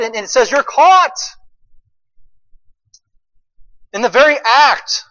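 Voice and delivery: loud, high, driving sermon climax; preaching at full force. Acted. Pastor driving home sin to congregation, preaching at full force.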